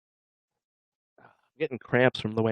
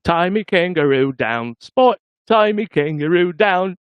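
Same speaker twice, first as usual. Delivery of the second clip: slow, half-sung Australian accent, jumping high on the last words; 6 semitones higher, 4 dB louder, slower than usual. Shown as a demonstration. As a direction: chant it in a hammed-up Australian accent. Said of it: "This is Australian".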